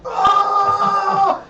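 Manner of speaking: very high, loud startled yell; Chris Farley scream. Retold or imitated. Friend imitating the cameraman's panic, Chris Farley scream. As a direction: scream like a sleeper jolted awake. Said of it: "Chris Farley just goes".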